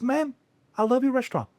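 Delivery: high-pitched imitation of an admiring guest; mock high-voiced praise; John Malkovich. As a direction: lift the voice well up, quoting a fan. Imitating John Malkovich greeting him.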